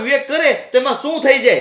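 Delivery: usual speaking voice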